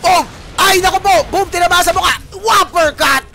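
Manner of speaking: high, full-force shoutcast of the kills; excited play-by-play. Real, not acted. Caster calling kills in a team fight, excited shouting. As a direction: shout each hit as it lands.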